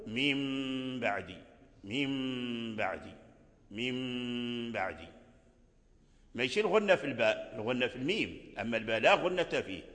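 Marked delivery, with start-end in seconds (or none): 0.1-5.1 slow, soft من بعدي with the meem hidden and humming into the ba; teacher drawing out the ghunna gently; nasal ghunna on meem. Shown as a demonstration. Teacher showing students the ikhfaa, slow nasal hum. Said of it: "فتقول".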